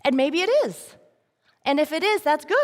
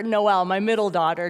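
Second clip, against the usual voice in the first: ordinary talk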